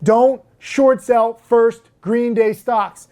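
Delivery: ordinary talk, nothing marked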